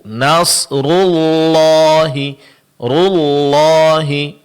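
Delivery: nasrullahi with rounded lips on ru, slowly drawn out; lips pursed. Shown as a demonstration. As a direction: purse lips on ru of nasrullahi, then relax for lam. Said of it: "dengan memonyongkan kedua bibir".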